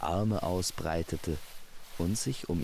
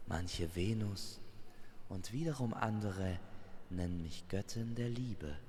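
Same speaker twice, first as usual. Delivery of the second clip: soft, measured reveal; hushed goddess, solemn and composed; mysterious goddess; 3 semitones higher, 7 dB quieter than usual. Acted. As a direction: reveal your names quietly, with pauses between. Goddess reveals her names.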